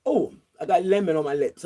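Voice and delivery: usual speaking voice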